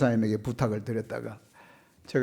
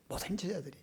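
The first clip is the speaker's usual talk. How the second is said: much softer, hushed repeat; soft echo; 7 semitones higher, 14 dB quieter, faster than usual. Real, not acted. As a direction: let the words fall to a hush. Loud then soft repeat. (훨씬 작게 속삭이듯 되뇌며)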